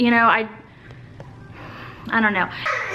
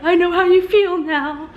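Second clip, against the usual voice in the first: mock sympathy; 6 semitones higher than usual. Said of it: "and now I'm like".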